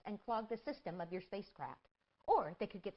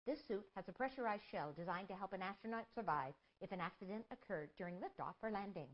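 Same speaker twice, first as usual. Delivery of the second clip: normal talking voice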